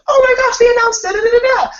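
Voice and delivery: very high, loud, fast squeal; high-pitched loud fan shriek; fangirl squeal. Retold or imitated. Acted fan reaction to announcement.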